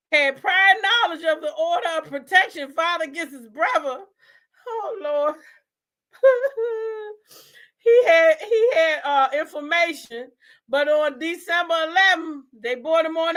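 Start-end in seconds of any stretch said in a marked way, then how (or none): 6.2-7.1 high squeaky giggle; a short breathless giggle. Real, not acted. Laughing at the case.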